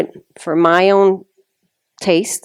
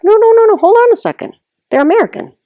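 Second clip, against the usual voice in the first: much higher, louder insistent correction; gentleman objecting; 4 dB louder, much faster than usual. Retold or imitated. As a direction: cut her off like a man setting her straight. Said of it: "And he's like".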